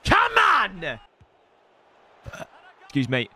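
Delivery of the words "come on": high, long celebratory yell; triumphant shout. Real, not acted. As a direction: roar it out after scoring. Celebrating in game.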